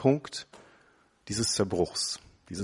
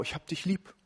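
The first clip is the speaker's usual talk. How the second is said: high, breezy 'Ich hab dich lieb' with a lifting end; offhand affection, pitched up; casual 'love you'; 8 semitones higher, 3 dB quieter than usual. Pretend. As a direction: toss it off lightly to a wife. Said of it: "Sag mal deiner Frau".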